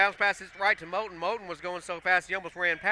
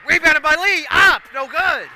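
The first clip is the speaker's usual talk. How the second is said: much higher and much louder through the scramble; excited shout; 9 semitones higher, 9 dB louder than usual. Real, not acted. Announcer calling the loose-ball scramble, excited shout. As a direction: yell every rebound. Scramble for rebounds called live.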